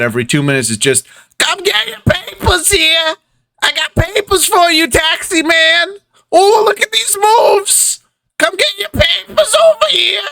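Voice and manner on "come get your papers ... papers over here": very high-pitched little newsboy hawking papers; paperboy child. Acted. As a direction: cry your wares in a piping kid's street call. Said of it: "a small ribbit child dancing".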